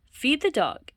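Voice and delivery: usual speaking voice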